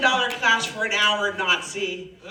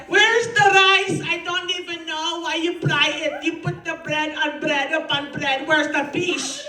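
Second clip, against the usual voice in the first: higher, exasperated mom demanding rice; Filipino mom; 4 semitones higher than usual. Retold or imitated. Filipino mom at the restaurant, higher whiny demand. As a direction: nag for rice like an indignant mother. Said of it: "'Cause my mom's a Filipino".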